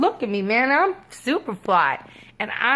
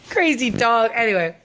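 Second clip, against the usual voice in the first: ordinary delivery, nothing marked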